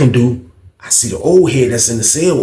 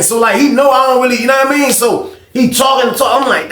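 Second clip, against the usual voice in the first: ordinary talk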